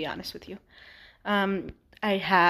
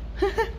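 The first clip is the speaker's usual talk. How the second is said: short laugh; 13 semitones higher than usual. Real, not acted. Laughs at own slip of tongue.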